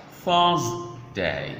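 "Thursday" split as "Thurs... day"; syllable by syllable. Shown as a demonstration. Teacher breaking the word down for learners, slow split syllables. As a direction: draw out "Thurs", pause, then "day". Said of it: "how to pronounce days of the week".

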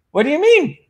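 high, puzzled what do you mean; asking Gary what he means, with a high puzzled question; confused question. Retold or imitated. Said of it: "I went".

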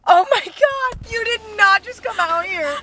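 thrilled and stunned; shocked squeal. Real, not acted. Friend appears unexpectedly.

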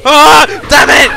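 loud startled yell; startled scream. Real, not acted. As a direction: scream in sudden fright. Jump scare in horror game.

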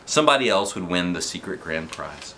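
plain reading voice

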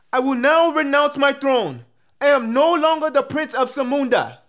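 high, slow, stately royal proclamation; proclaiming prince. Acted. Actor auditioning as a prince, proclaiming to all. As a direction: declare it grandly, drawing it out on a high held pitch.

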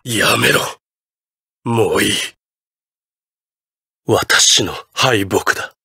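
low, halting, spent voice; defeated. Acted. Villain conceding defeat to his opponent, drained and low.

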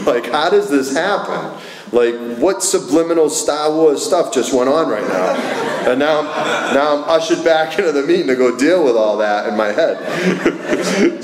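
laughing in disbelief. Real, not acted. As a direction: laugh at how absurd it was. Laughing at his own rejection.

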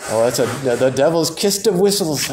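put-on a light, half-hearted Scottish accent on "the devil's kist of whistles"; a modest stab at a Scottish accent. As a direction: try a Scottish minister's accent, admittedly not very well. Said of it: "I can kinda hear a Scottish guy saying that".

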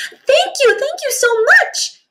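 very high, bubbly delighted thanks; playful thanks. Acted. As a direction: squeal with joy. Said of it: "bubbled playfully".

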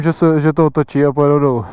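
usual speaking voice

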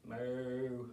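low, soft, drawn-out moo; cow moo. Acted. Storyteller voicing Bessie the cow, soft moo.

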